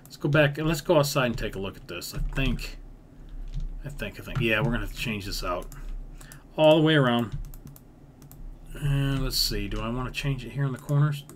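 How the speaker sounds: ordinary talk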